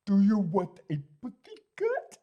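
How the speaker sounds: rough imitation of a Russian accent; bad Russian accent. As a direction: imitate a Russian woman, badly. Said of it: "I can't do a Russian accent".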